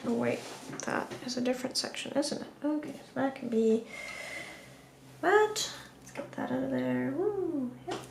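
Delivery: drowsy, mumbling half-asleep; barely awake, words drifting; nodding off. Real, not acted. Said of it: "Guys, I'm falling asleep".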